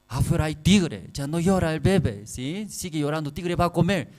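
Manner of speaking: high, loud scolding warning; mother threatening. Retold or imitated. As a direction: frighten the baby, high and loud. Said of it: "Su madre dice". (madre asusta al bebé con el tigre, aguda y fuerte)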